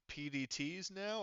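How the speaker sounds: ordinary talk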